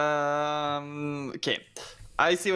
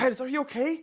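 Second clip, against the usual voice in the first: high-pitched worried call; alarmed concern; 11 semitones higher, faster than usual. Acted. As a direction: check urgently on a hurt friend. Calls out to injured friend in game.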